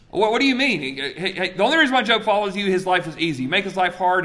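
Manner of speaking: high, loud, fast objection; indignant arguing. Retold or imitated. Satan arguing to God about Job, indignant protest.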